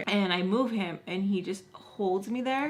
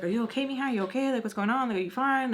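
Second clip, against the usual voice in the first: higher-pitched rapid questions; retold anxious check-in, pitched up; concerned fussing; 5 semitones higher, much faster than usual. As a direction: imitate worried girlfriends checking on her. Retold girls fussing over her.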